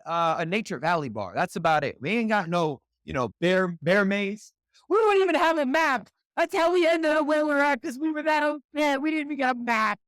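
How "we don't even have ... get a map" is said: high-pitched, exasperated rant; indignant high-pitched outburst; worked up. Real, not acted. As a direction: get carried away, voice jumping way up. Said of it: "we're getting worked up here".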